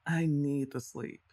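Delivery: usual speaking voice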